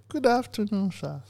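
squeaky high falsetto wife; high woman voice. Acted. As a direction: pitch way up into falsetto.

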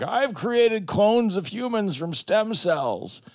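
high, slow mad scientist voice; rogue scientist voice. Retold or imitated. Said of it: "some rogue scientist who's like".

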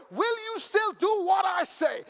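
slow, loud challenging question; preaching shout. Real, not acted. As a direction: drive the question home slowly.

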